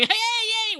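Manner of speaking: high-pitched, drawn-out yay; retold cheering. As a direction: re-enact a gleeful celebration. Re-enacting celebration after CEO leaves.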